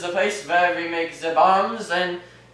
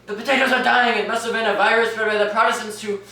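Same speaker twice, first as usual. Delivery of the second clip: ranting Irish father; 7 semitones higher, 5 dB louder than usual. Acted. Father ranting to his wife about the blight, loud and high. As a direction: wail about the potatoes like the world is ending. Father despairs over dying potatoes.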